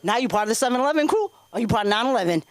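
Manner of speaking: ordinary delivery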